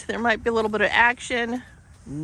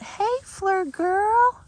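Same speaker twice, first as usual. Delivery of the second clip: pet talk voice; 13 semitones higher, much slower than usual. Real, not acted. Greeting horse in high voice.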